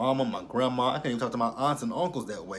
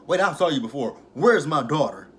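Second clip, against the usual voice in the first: higher, much louder mock confrontation; raised-voice demand in a retelling; retold angry demand; 3 semitones higher, 8 dB louder than usual. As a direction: act out someone loudly demanding answers.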